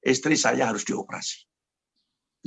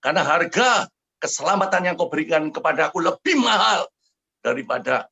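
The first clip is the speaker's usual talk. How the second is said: impassioned declaration; 7 semitones higher, 4 dB louder than usual. Retold or imitated. Preacher's impassioned declaration to God.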